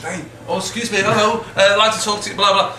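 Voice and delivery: lightly acted bid for someone's attention; calling out. Retold hailing of a politician.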